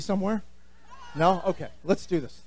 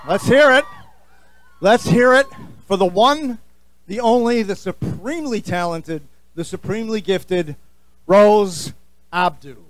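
hype announcer; 2 semitones higher than usual. Acted. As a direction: announce the guest like a ringside host. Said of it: "introduces guest".

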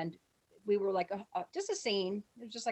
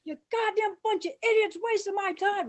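high, loud furious yelling; screaming tirade; 12 semitones higher, 7 dB louder than usual. Retold or imitated. Director screaming at extras who got it wrong, furious. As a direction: yell it, berating the whole crowd. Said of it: "Lloyd starts screaming".

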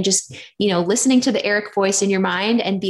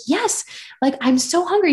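high, pleading relief; desperate eager yes; 9 semitones higher than usual. Retold or imitated. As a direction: grab the offer like you're starving. Retold eager answer to food offer.

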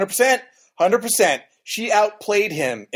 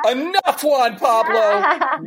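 high, drawn-out cry of protest; exasperated outcry; 10 semitones higher, much slower than usual. Retold or imitated. Viewer yelling at Juan Pablo, exasperated high outcry. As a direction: cry out as if fed up, pitched way up. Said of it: "And I went".

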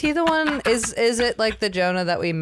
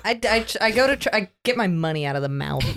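usual speaking voice

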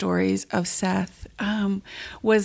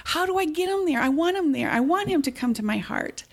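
put-on a touch of an eager child's high question; excited little boy; 8 semitones higher, faster than usual. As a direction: lightly voice the boy's excited pleading. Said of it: "And he was so excited".

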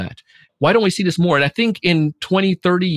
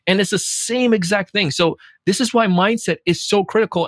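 ordinary talk

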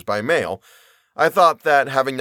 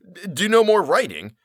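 incredulous; 8 semitones higher, 3 dB louder than usual. A narrator's reading. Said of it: "exclaimed I".

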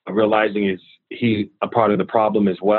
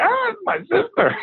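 put-on much higher, a frightened kid's yelp; panicked squeal. Recalling blaming his sister out of fear, a panicked high yelp. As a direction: blurt it out in a sudden panic. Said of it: "out of fear".

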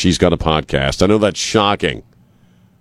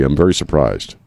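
normal talking voice

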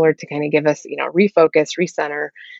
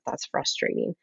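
soft whisper, almost no voice; a hushed, understanding aside; whispered empathy; 3 semitones lower, 11 dB quieter than usual. Shown as a demonstration. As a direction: breathe it gently to the child. Validating a child's upset feelings.

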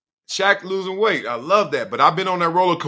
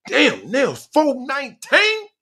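high shocked disbelief; 13 semitones higher, slower than usual. Retold or imitated. Shocked at scale.

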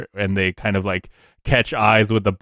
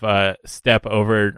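ordinary talk, nothing marked

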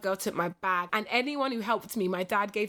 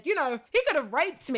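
joking tone; 5 semitones higher than usual. Retold or imitated. Telling her friend as a joke, playful. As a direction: toss it off as a joke. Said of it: "But as a joke".

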